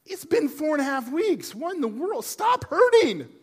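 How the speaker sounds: fed-up patient pleading with his own body; exasperated inner voice. Retold or imitated. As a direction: beg the pain to quit, stretching the last words. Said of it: "I thought".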